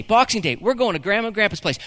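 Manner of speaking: usual speaking voice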